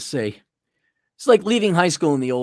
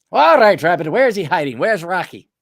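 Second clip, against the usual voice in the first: gruff cop; 2 semitones higher, 6 dB louder than usual. Pretend. Cop at the door demanding where Rocky hides, loud tough cop. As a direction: bark like an old movie cop.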